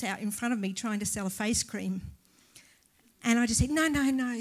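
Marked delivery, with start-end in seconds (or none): 3.7-4.4 loud, higher retold refusal; brisk brush-off. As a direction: re-enact waving off a pushy salesman, louder. Brushing off a face-cream salesman.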